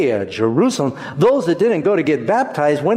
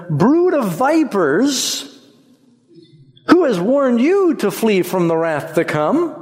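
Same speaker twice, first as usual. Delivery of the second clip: slow, higher, thundering rebuke on "Brood"; fiery prophet; 7 semitones higher than usual. Retold or imitated. John rebuking the Pharisees, higher and slow. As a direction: denounce them like a wilderness prophet. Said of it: "He said to them".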